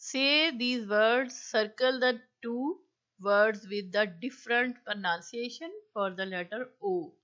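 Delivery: slow, loud, deliberate read-aloud; dictation reading. Acted. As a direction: spell out each word for the class. Reads the task instruction slowly.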